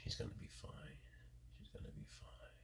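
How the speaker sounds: hushed reassurance; soothing whisper. Real, not acted. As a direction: whisper it to calm her. Reassuring animal softly.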